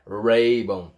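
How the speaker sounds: RAYBON opening on a curled English R, low; pulled caipira R. Shown as a demonstration. Teacher shows learners the English R, pulled R in RAYBON. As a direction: draw out the R starting RAYBON. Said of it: "um R mais puxado".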